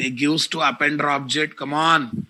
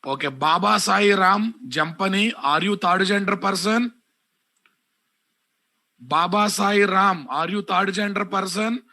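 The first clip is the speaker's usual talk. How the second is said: angry scolding; 4 semitones higher than usual. Real, not acted. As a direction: call him out angrily by name. Teacher scolding and calling out student.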